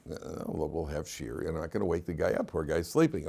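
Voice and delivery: low, soft hush so the sleeper stays asleep. Retold or imitated. Telling him not to wake sleeper.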